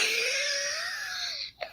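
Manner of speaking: high, howling burst of laughter; real helpless cackle; shrieking laugh. Real, not acted. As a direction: scream with laughter. Bursts out laughing at her story.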